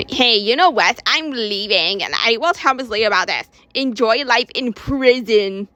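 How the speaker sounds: mildly acted huff; indignant snapping. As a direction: threaten to tell, drawing out the parting shot. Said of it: "the way he snapped".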